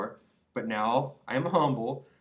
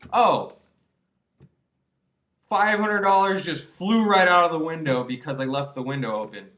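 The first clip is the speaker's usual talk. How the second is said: loud, alarmed cry over lost money; mock alarm; 3 semitones higher, 8 dB louder than usual. Pretending cash blew out the window, loud mock alarm. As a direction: play it as if the cash just flew out. Acted money-loss scenario.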